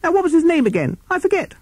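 very high-pitched little bat; squeaky Bat. Acted.

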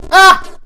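sharp high scream of fright; startled scream. Real, not acted. Player screams at a jump scare on screen, shrieking.

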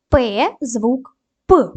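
П drawn out clearly, very slow; slow sound demo. Shown as a demonstration. Teacher models the sound П for pupils. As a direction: sound out the letter П slowly. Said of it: "дамо характеристику кожному звуку".

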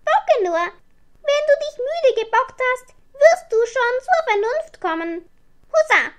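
high, loud, defiant little witch; kleine Hexe rufend. A narrator's reading. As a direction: shout at the bucking broom in a high voice. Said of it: "rief sie dem Besen zu". (hohe Hexenstimme, trotzig gerufen)

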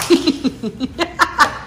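high giggle escaping after an apology; fail to hold in the laughter; laughing. Real, not acted. Mother trying to apologize to singing child, giggling. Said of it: "No, don't laugh".

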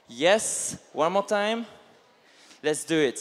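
ordinary talk, nothing marked

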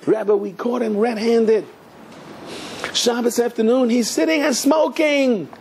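high-pitched, drawn-out mock denunciation; scandalized report. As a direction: play breathless tattletales telling the rabbi. Followers run back to denounce him.

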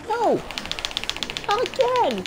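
loud, high cry of dismay; frustrated yell. Real, not acted. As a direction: yell it in disbelief.

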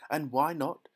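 high, loud demand; shrieking. A narrator's reading. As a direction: shriek back for an explanation. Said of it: "shrieked Missus Gloop".

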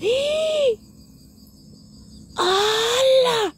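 high startled exclamations; sudden high cries of wonder; astonished shouts. Real, not acted. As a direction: gasp it out in real amazement. Sees it rise.

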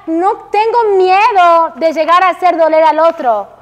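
usual speaking voice

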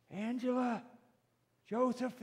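high calling voice without shouting; lifted, unforced parental call; calling names. Acted. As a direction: call the children as heard from a distance. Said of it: "they hear their name by their mom or dad".

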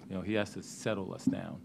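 normal talking voice

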